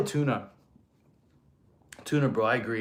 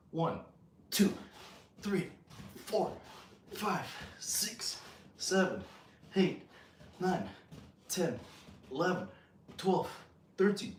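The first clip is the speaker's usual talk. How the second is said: quiet, high strained rep count; real effort in the voice, softly counted; push-up counting; 4 semitones higher, 10 dB quieter than usual. Real, not acted. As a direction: count each rep under exertion, in short bursts. Counting reps while doing push-ups.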